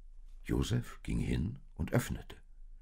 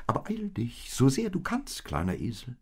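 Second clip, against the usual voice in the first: much higher little animal voices; urging little ones; 8 semitones higher, 3 dB louder than usual. Acted. The little ones urge the donkey to hurry, much higher.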